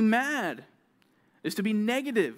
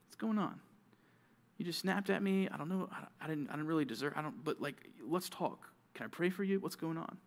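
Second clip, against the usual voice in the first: soft, hesitant, caring check-in; gentle concern; 4 dB quieter than usual. Acted. Friend who was snapped at, gently offering to talk and pray. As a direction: ask softly, feeling your way toward peace.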